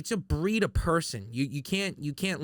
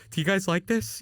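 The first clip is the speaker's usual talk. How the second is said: a hint of an eager kid showing artwork, higher pitch; lightly acted plea for approval, pitched up; 5 semitones higher than usual. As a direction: ask it higher, like showing off a drawing. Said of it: "I immediately wanna be like".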